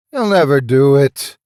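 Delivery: loud, assured; confident proclamation. Acted. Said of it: "Mark confidently proclaimed".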